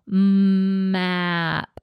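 Map with the m and ah stretched, p quick; teacher showing which sounds to stretch; slow m and ah. Shown as a demonstration. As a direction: hold the m and ah, snap the p. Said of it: "make the m and the ah slow".

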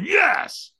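high-pitched retold shout of commitment; pumped-up cheer. Firewalker throws hands up for trainer, excited shout. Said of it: "I throw my hands in the air".

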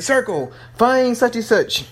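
high, slow command to an app; put-on high voice giving a device an order; imitated voice command. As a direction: address a machine by name, drawn out.

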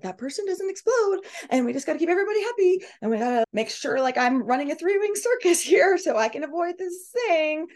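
high-pitched, rushed mock-anxious chatter; worked-up and high, like juggling a circus; anxious, controlling. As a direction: act out someone frantically keeping everybody happy. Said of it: "If you're feeling very anxious, worried".

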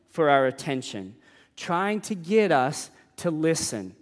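usual speaking voice

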